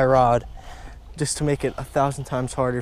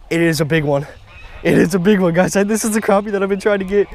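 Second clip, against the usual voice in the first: loud, raised, insistent exclaiming; real thrilled excitement; 5 semitones higher, 5 dB louder than usual. Real, not acted. Angler fighting the big crappie he's been after, real thrill.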